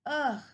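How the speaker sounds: loud groaning "ugh" of a sick man. Acted. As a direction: groan it out like you feel awful. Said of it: "Maybe he's tired and sick".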